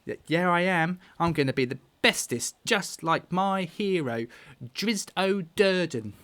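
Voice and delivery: put-on small boy declaring his big ambition; Carp, proud boy. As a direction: boast like a hero-worshipping child. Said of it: "pumps his chest up".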